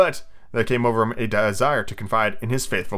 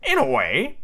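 lilting sidekick voice; pirate underling voice; Smee; 2 semitones higher, slower than usual. Acted. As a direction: venture it timidly as the henchman. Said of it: "said Smee".

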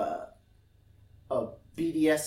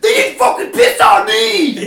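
shouting, much higher and louder, losing it; furious shout; 18 dB louder than usual. Retold or imitated. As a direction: explode in outrage like he's been insulted. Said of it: "Just, like, lost his shit".

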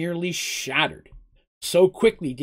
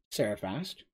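plain reading voice

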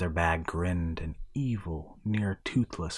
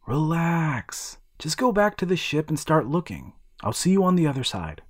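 lightly voiced boy, a bit higher, urgent; a young boy laying out the plan, slightly raised; boy reassuring his golem, lightly voiced; boy hero; 7 semitones higher than usual. Boy sending golem away.

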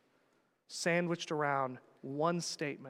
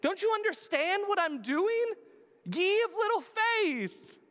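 high, loud mock Jesus scolding his disciples; Jesus chiding; 5 dB louder than usual. Jesus scolding the frightened disciples, high and loud. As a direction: chide them like an exasperated teacher. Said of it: "he's gonna say".

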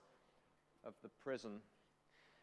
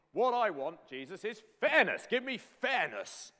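loud, raised-pitch mock demand; indignant demand; 5 semitones higher, 9 dB louder than usual. Us demanding fairness from Jesus, loud hammed-up indignation. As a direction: demand it like an aggrieved complainer. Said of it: "we are demanding fairness".

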